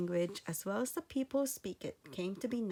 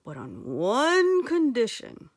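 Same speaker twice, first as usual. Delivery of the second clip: loud, slow lordly eagle; great eagle; 10 dB louder, slower than usual. Acted. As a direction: grant help slowly like a great bird. Eagle voiced in story.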